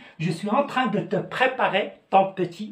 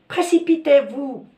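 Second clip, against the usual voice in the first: mock impatient order; 7 semitones higher, 5 dB louder than usual.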